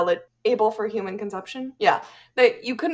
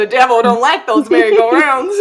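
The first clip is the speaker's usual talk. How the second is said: mock preacher; 8 dB louder than usual.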